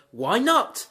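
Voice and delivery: surprised question. Retold or imitated. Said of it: "And she goes".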